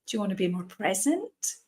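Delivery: ordinary talk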